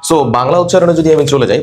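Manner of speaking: ordinary talk, nothing marked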